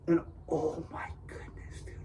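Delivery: voiceless, stunned murmur of wonder; awed whisper. Real, not acted. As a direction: breathe it out in hushed amazement. Awed at the animation.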